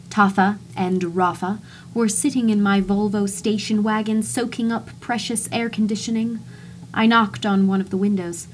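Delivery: plain reading voice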